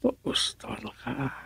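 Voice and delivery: a ghostly hushed call; eerie whisper. Acted. Drama opening teaser, eerie whisper to the listener. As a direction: breathe it out as a whisper.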